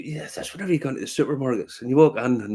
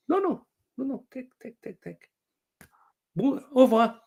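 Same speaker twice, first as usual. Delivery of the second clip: high, fading insistent pushing; lightly voiced insistent seller; mock insistent Frenchman; 11 semitones higher than usual. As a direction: press the knife on him.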